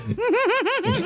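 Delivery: high, helpless burst of laughter; real laugh. Real, not acted. Cracking up at a joke.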